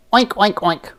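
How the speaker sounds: high oinking; playful pig grunts; pig oinks. Acted. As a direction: oink like a pig.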